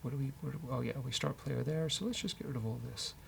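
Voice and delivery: ordinary talk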